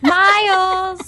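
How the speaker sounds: "Miles" drawn out as "my-uls"; exaggerated, slowly sliding "Miles"; two-syllable Miles. Shown as a demonstration. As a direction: stretch "Miles" high and loud into two beats. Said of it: "Miles is a little more difficult".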